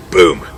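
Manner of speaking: short, low, loud boom; sudden low booming shot; imitated gunshot. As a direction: punch out a deep gun blast. Imitating another rifle shot.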